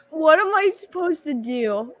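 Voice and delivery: high-pitched retold plea; mock helpless whine. Acting out own helpless panic.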